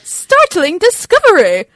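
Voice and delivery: slow, loud, high mock British accent; Ross's bad accent. Quoting Ross teaching his students, bad British accent. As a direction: draw it out in a terribly faked British accent. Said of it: "as bad as Ross's accent".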